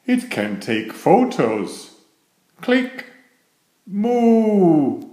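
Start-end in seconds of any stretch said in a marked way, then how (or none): 2.6-3.1 high, crisp "click" like a shutter; camera click sound. A narrator's reading. Camera taking photos. 3.9-5.0 high, drawn-out playful cow moo. A narrator's reading. Playing Moo Cow game.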